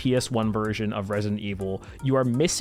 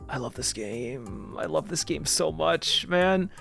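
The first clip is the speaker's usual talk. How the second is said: soft gushing; 4 semitones higher, 4 dB quieter, slower than usual. Real, not acted. Gushing over Zelda.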